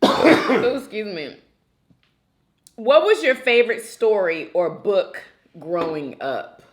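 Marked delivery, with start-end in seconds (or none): none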